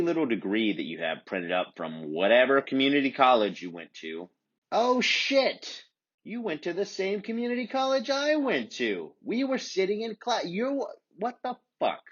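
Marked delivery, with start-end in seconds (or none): 4.7-12.0 mock shock. Shocked realization, high far.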